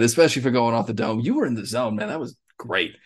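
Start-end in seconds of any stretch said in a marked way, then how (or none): none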